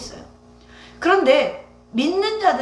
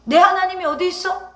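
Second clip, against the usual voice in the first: raised, louder jeering question; mocking taunt; 6 semitones higher, 5 dB louder than usual. Scoffers taunting David about his God, in a raised jeering voice. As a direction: throw it out like a sneering challenge. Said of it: "다윗도 조롱을 당했어요".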